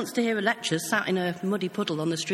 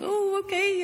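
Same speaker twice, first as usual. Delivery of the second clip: high, airy brush-off; mock breezy okay; 11 semitones higher, slower than usual. To the shouting street preacher, a high mock-casual brush-off. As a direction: let it go with a bright shrug. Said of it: "So I'm thinking".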